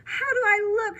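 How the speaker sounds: high, bright-eyed question; excited. Acted. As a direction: show off the new hairdo. Mom whirls, radiant.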